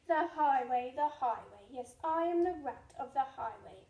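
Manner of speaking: loud gruff bandit rat demanding food; rat cries out. Acted. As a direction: boom it out like a greedy highwayman rat. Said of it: "who cried out".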